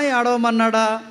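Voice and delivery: ordinary talk, nothing marked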